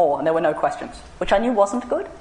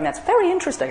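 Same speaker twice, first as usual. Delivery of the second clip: sing-song fake politeness, pitched much higher; mock polite; 12 semitones higher than usual. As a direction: parody a bland, nice interviewer. Polite interviewer cliché, mocked.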